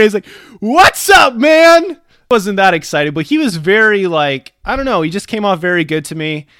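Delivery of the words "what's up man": much higher, shouted hello; loud greeting. Retold or imitated. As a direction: call it out over pouring rain. Clerk greeting over the rain.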